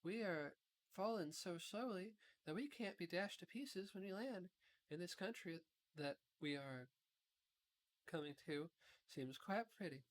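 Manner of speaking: put-on calm, serious reassurance from the girl; sober Dorothy. A narrator's reading. Dorothy continues her speech.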